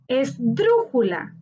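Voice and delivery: Esdrújula slowly, with DRÚ stretched and punched; stress on DRU. Shown as a demonstration. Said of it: "Su sílaba tónica está en dru". (Esdrújula lenta, con acento marcado en DRÚ)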